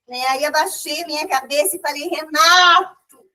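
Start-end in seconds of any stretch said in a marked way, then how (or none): 2.1-3.3 drawn out, slow, louder call of Renato; calling out name. Retold or imitated. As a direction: stretch Renato long like a loud plea. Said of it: "comecei a chamar ele na mente". (chamando Renato bem alongado, devagar e mais alto)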